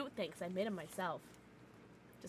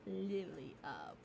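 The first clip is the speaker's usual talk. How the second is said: spooky voice; 14 dB quieter, much slower than usual. Pretend. Spooky reading of name.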